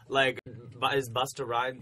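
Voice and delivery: usual speaking voice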